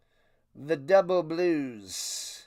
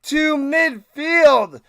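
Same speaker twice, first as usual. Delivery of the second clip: loud, high-pitched TV play-by-play; hyped announcer call; 7 semitones higher, 9 dB louder than usual. Acted. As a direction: sell the kickoff like a big-game broadcaster. Hyped broadcast-style kickoff call.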